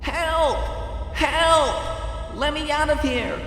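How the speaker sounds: high, loud cries for help, drawn out; desperate high yelling, let me out stretched long; screaming. A narrator's reading. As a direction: pound the walls and scream to be let out. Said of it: "screaming at the top of her lungs".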